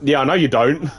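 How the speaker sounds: normal talking voice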